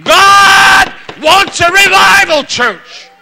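loud, high, emphatic revival preaching shout; full-force preaching. Real, not acted. Preacher urging the church toward revival, full-force shout. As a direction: preach it out in forceful bursts with long pauses.

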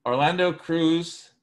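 ordinary talk, nothing marked

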